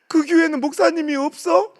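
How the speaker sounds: put-on high, loud indignant little girl; child's question. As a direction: demand an answer like a child. Daughter questioning dad. (따지듯 묻는 어린 딸)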